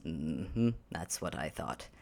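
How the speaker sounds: low, soft smug murmur; knowing I-told-you-so; smug Ansel. Acted. Ansel smugly answers the cauldron that finally moved.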